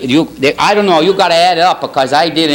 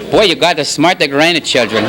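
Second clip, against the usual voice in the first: normal talking voice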